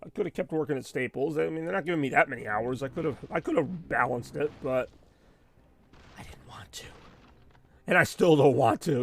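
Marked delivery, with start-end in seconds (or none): 6.2-6.9 a soft unvoiced confession; whispered admission. Real, not acted. Admitting to the stream she didn't want to work, whispered. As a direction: whisper it under your breath.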